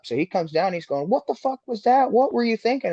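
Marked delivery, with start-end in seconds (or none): 1.1-2.9 high-pitched imitation of an angry rival; fired up. Rival driver walking up after the wreck, fired up. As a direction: confront him, voice jumped way up. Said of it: "he's fired up".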